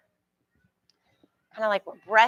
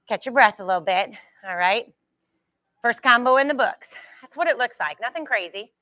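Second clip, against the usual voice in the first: normal talking voice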